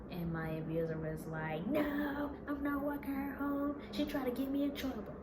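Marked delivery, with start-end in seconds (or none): 1.7-5.2 loud, high indignant protest; angry refusal. Retold or imitated. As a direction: refuse outright, raised voice and pitch. Boyfriend angrily refuses to walk her.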